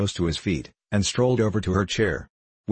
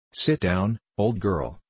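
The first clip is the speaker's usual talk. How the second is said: ordinary delivery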